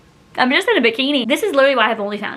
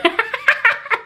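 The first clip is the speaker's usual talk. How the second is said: giggle; 11 semitones higher than usual. Real, not acted. Laugh about ex.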